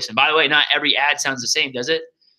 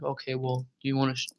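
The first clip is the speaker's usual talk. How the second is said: mildly imitated, much softer, giving up; deflated rep; 5 dB quieter than usual. Rep offers the gallery set after a customer says no, deflated. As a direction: let the energy drain out after the no.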